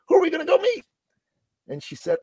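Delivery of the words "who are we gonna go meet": squeaky-high imitation of an eager kid; acted boyish excitement, pitched way up; excited little boy. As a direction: ask it like a child bursting to know. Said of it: "in the excited way little boys do".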